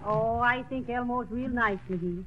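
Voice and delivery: ordinary talk, nothing marked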